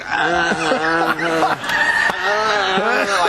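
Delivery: mock crooning lead singer; mock rock-star wail; posing lead singer. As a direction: ham up a chest-out frontman. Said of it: "just like".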